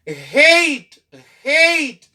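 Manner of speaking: "Hate" with A said as "ay"; long A. Shown as a demonstration. Teacher shows how added E changes sound, long A. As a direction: punch "Hate" high and falling, long A. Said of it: "Li vin chanje son A".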